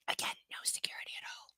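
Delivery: barely voiced, very soft whisper; whispered aside. Real, not acted. Reader slipping in a comment on security, whispered aside. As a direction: drop to a quick whisper under the reading.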